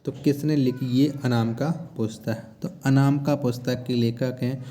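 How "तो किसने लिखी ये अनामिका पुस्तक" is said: normal talking voice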